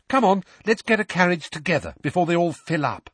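brisk Hermione. A narrator's reading. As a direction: hurry the others along.

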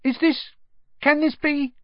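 put-on high, breathless astonished old barman; a barman who can't believe his eyes, high and amazed. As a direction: gasp it out in disbelief.

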